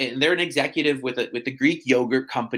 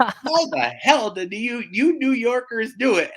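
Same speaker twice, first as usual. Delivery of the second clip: retold astonishment; 8 semitones higher, 3 dB louder than usual. Client asking New Yorkers about taxes, mock astonishment. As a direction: act out the baffled client, pitch raised. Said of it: "His mind was utterly blown".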